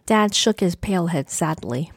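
ordinary delivery

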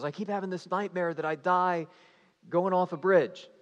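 mock alarm, voice lifted; mildly panicked retelling. Chaperone explaining her nightmare to the van, mild mock panic.

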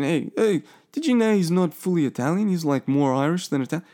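high-pitched mock conversation opener; imagined know-it-all. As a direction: play someone eagerly passing on trivia. Voices viewer sharing a fact.

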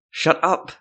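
angry words forced through clenched teeth. Acted. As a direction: snap it out with jaw locked tight. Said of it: "through clenched teeth".

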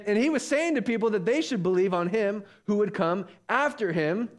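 ordinary delivery

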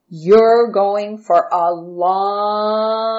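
very slow, stretched-out threat; menacing taunt. A narrator's reading. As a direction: drag out the last word ominously. Brother taunts Joseph about his fate.

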